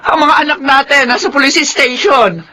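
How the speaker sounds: high, tearful announcement; wife telling husband through tears; crying. Acted. Mother tells husband, crying. (umiiyak na pagbabalita)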